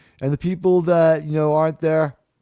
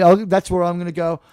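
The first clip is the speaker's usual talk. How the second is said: usual speaking voice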